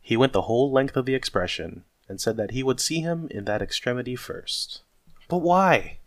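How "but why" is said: high, loud protesting question; pleading outburst, pitched well up; crying out. Acted. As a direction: exclaim in disbelief. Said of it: "cried Scrooge's nephew".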